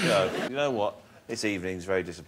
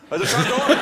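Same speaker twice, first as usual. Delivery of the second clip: laughing; 10 dB louder than usual. Real, not acted. Laughing at his own joke.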